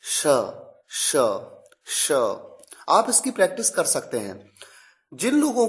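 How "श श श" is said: "श" repeated as separate short sh syllables; crisp practice repetitions of "श"; sh sound demo. Shown as a demonstration. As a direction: say "श" alone each time, pausing between. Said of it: "इस तरह का sound है".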